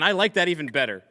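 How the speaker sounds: ordinary delivery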